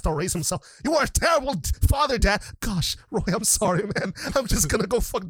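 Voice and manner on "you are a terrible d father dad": hammed-up angry son; mock accusing Roy. Roy voiced accusing father.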